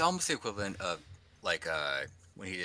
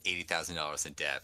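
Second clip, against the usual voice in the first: usual speaking voice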